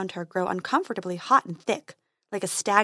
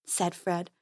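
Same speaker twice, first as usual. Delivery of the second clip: plain reading voice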